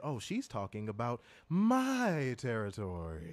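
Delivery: low, slow, savored boast; mock-possessive, lingering drawl; playful territorial claim. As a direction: draw it out low, staking your claim.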